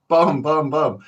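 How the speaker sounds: loud punchy bum bum bum for each swing; bat blow sounds. Retold or imitated. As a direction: mimic the thwack of a baseball bat. Imitating the bat blows.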